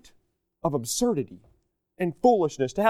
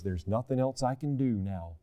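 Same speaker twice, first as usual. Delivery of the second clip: quiet, grave God voice; 7 semitones lower, 8 dB quieter than usual. Acted. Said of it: "And God says".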